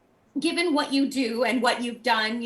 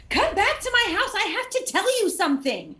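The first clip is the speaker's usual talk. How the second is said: put-on emphatic child call; 8 semitones higher than usual. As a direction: insist like a little boy who has news to share. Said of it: "Just very emphatic".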